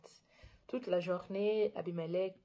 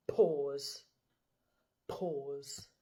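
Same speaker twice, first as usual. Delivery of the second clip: lower, fading pause calls; low repeated; 5 semitones lower than usual. Real, not acted. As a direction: drop the voice each time. (voix plus grave qui s'éteint)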